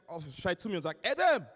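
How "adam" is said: loud, high call down a hallway; imitated schoolmate shouting after someone; buddy's shout. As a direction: yell the name to stop a running friend. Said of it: "mein Kumpel schreit".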